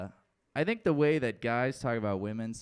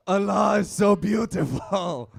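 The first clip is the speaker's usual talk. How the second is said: high, loud, slowly drawn-out mock rapture; awestruck cry; 8 semitones higher, 8 dB louder, slower than usual. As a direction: shout it slowly to the heavens in exaggerated awe.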